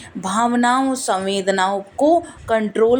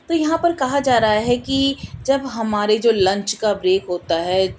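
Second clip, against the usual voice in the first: usual speaking voice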